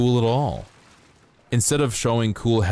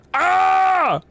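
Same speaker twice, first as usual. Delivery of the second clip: a sudden very high, loud shriek; jump-scare yell; 5 dB louder than usual. Pretend. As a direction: burst out with a shrill scream to startle. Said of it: "Did that scare you?".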